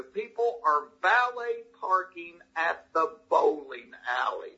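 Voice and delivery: slow, spelled-out disbelief on "valet parking at the bowling alley"; incredulous emphasis. Retold or imitated. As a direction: stretch "valet parking" and "bowling alley" word by word to make the point. Comic incredulous retort to Michigan club crowd.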